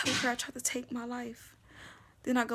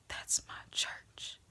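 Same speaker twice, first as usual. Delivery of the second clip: awed hush; 8 dB quieter, slower than usual. Retold or imitated. Chaplain's awed reveal.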